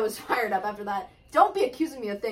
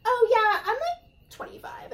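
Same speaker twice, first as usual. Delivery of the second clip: high, breezy fibbing about her age; lightly voiced older woman lying about her age; mock older woman; 11 semitones higher, slower than usual. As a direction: play the woman shaving years off, airy and high. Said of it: "the older women that's like".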